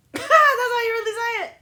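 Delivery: high, loud laughing question; laughing disbelief. Real, not acted. Learning how others really say it, laughing disbelief. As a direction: burst out laughing as you ask.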